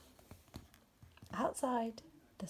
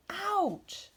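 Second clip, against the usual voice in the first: sharp high yelp of pain; ouch; 11 semitones higher than usual. A narrator's reading. Wolf yelps as the carrot stings his nose. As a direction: yelp as something jabs your nose.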